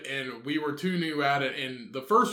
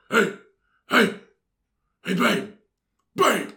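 hoarse superhero call; Batman; 6 semitones higher, 6 dB louder than usual. Acted. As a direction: punch out each call in a hero's rasp. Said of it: "I get my best Batman voice".

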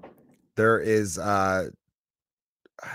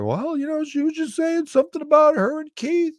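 high barfly voice; 4 dB louder than usual. Retold or imitated. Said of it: "And then the guy's like".

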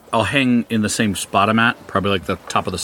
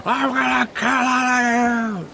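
mock murderous scream. Shown as a demonstration. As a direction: scream a threat under your breath. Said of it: "Not like, not like".

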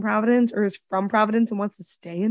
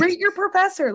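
higher, sing-song parody of a happy customer; mock cheerful reviewer; 9 semitones higher than usual. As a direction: lightly send up a sunny reviewer, pitch raised.

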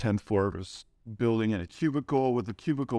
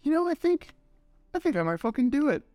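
excited; 6 semitones higher than usual. Retold or imitated. Retelling his excited reply to friend.

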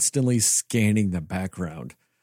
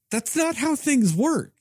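high, slow, incredulous mock reply; exasperated retort; 12 semitones higher than usual. Co-host mocking a rich man's buyback plan, incredulous and slow. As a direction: spell it out like it's obvious, pitched way up. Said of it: "And I'm like".

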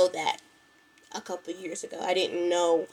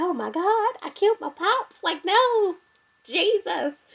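much higher, mock-frantic wail; mock despair; 11 semitones higher than usual. Telling listeners about her father's food poisoning, mock frantic. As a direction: act out thinking you killed your dad. Retold panic over sick father.

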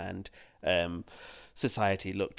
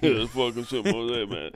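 loud Stallone mumble; Rocky impression; 4 dB louder than usual. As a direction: ham up Rocky Balboa. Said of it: "Sylvester Stallone".

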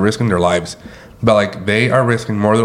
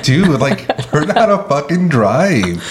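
higher, exasperated yelling at drivers; mock road rage; 6 semitones higher, slower than usual. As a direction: laugh while scolding a bad driver. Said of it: "And I'm like".

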